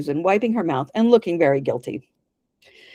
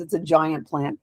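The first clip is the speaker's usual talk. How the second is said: ordinary delivery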